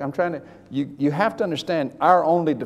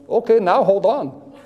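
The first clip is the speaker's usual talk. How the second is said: slow, louder mock Indian accent; 5 semitones higher, 5 dB louder, slower than usual. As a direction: imitate a doctor's Indian accent, drawn out. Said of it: "in his best Indian accent".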